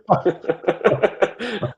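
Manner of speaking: high, loud burst of laughter; real laughing burst. Real, not acted. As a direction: burst out laughing at your own crude slip. Laughs after swearing about dog mess.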